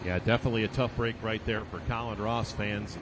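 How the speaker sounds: ordinary delivery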